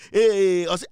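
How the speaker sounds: ordinary delivery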